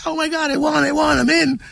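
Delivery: mock ecstatic friend cheering. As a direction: squeal it like you just won. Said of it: "He comes out ecstatic".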